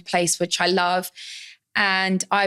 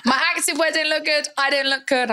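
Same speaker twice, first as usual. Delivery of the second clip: laughing; 7 semitones higher than usual. Real, not acted. Laughing self-mockery continues.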